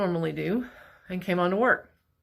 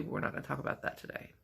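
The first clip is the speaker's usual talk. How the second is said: quiet aside; 3 semitones lower, 11 dB quieter, faster than usual. Real, not acted. Dodging the drinking topic.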